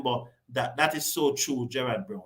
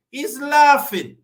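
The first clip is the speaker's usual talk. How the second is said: LAUGHING drawn out high and loud, slowly; outraged slow stress on laughing; indignant emphasis; 6 semitones higher, 7 dB louder, slower than usual. Real, not acted. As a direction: lean hard on "laughing", higher, louder and slow. Indignant commentator, Indians laughing.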